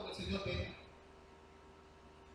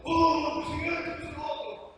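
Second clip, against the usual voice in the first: loud, higher mock preacher yell; shouted preaching; 4 semitones higher, 5 dB louder than usual. Preacher imitating himself shouting to the congregation, loud shouted preaching. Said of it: "cuando estoy gritando".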